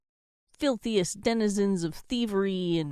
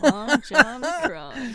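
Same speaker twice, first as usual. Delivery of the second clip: drawn out; 6 semitones higher, 6 dB louder, much slower than usual. Acted. Savoring the silly name.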